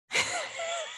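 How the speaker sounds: a sudden high squeal of laughter. Real, not acted. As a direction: burst into a squeaky giggle. Reacting to friend's reversal.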